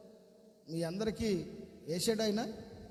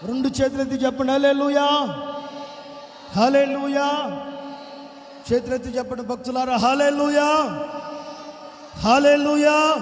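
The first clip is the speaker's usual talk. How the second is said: louder, higher, stretched preacher's cry; 5 semitones higher, 5 dB louder than usual. Real, not acted. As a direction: call it out long to rouse the congregation. Preacher calling out hallelujah.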